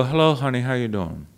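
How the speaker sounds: put-on elderly vocal coach's greeting, sinking low and soft; affectionate mimicry, falling away at the end; Helen Hodem. Student showing how the coach greeted people, low imitation. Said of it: "And she'd go".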